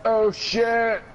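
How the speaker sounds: high, loud startled "oh shit"; real jolt of fright; startled cry. Real, not acted. Nearly jumping into the lava, a startled loud cry.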